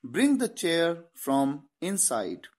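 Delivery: very slow, word by word; slow dictation English; very slow model sentence. Shown as a demonstration. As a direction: spell the sentence out slowly for students. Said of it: "teacher models English sentence".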